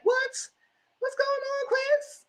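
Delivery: a shocked, scolding what; high-pitched disbelief aimed at Chris; incredulous high squeal. Real, not acted. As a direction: jump way up into a mocking falsetto.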